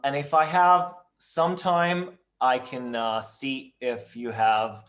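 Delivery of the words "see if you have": ordinary talk, nothing marked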